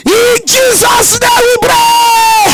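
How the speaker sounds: loud, high, slow drawn-out prayer close; shouted prayer. Real, not acted. Pastor closing the fire prayer, slow and loud. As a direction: stretch every word as a cry.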